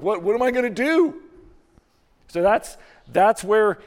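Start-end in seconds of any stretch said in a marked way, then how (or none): 0.0-1.2 panicked cry. Retold or imitated. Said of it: "They're like".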